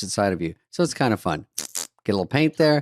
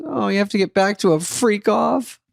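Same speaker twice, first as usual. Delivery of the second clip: high mocking mother; mother teasing son about Hollywood, high voice; mock mom; 10 semitones higher than usual. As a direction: scoff like a hurt mother. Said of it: "She goes".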